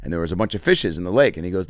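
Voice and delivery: ordinary talk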